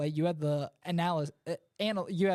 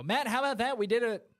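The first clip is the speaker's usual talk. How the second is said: excited; 5 semitones higher than usual. Real, not acted. Relief at finishing the scores.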